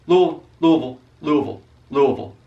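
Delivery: "Louisville" mumbled into LOO-uh-vul, repeated as a drill; three syllables as one. Shown as a demonstration. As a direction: squash "Louisville" into one run-together word. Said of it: "three syllables and put 'em in one word".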